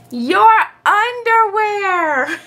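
high, slow, drawn-out exclamation; gleeful high motherly cry; amused mother's holler. A narrator's reading. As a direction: call it out, stretching every syllable. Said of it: "his mother laughed".

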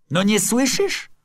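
high, loud, emphatic explaining; exasperated insistence. A narrator's reading. Sculptor explains to baffled neighbour, exasperated. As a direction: spell it out loudly, out of patience.

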